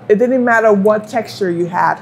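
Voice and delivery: usual speaking voice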